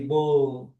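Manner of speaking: bol ending on a clear held L; final L pronounced. Shown as a demonstration. Teacher sums up the pronunciation, sounded L. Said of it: "a pronúncia é L".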